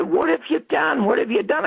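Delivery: high-pitched, outraged mock yell; retold screaming. Storyteller confronts the caller on the phone, high retold scream. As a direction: replay an angry outburst without raising the volume. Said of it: "I start screaming at him".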